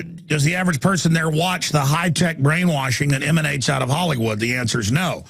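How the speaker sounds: normal talking voice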